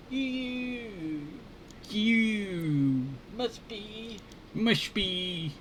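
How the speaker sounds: high-pitched trial of a crazy scientist voice; crazy high voice, feeling for the character; Larry. Acted. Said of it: "so I can copy the voice".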